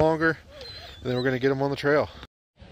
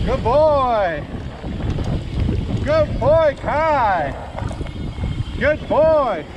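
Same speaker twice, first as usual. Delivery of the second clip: calling from far away; 9 semitones higher, much slower than usual. Real, not acted. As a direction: call out to the running dog. Calling dog on trail.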